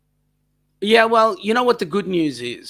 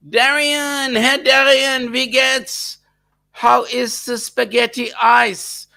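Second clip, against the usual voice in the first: playful greeting; 8 semitones higher, 3 dB louder, slower than usual. Pretend. Greeting German viewer, voice raised.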